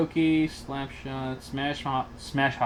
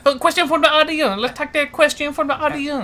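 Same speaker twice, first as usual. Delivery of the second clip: higher-pitched mock Vietnamese accent; 5 semitones higher than usual. Co-host taking audience questions as punishment, Vietnamese accent. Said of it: "I will do a Vietnamese accent".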